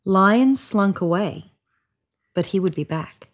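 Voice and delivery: ordinary delivery, nothing marked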